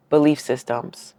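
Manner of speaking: normal talking voice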